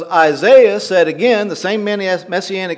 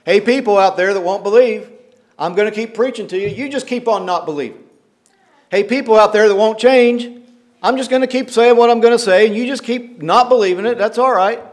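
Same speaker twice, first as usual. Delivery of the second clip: mocking, louder and higher, a shrug in the voice; heavy sarcasm; 5 semitones higher, 4 dB louder than usual. Preacher voicing the prophet to stubborn people, heavy sarcasm. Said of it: "It's heavy sarcasm".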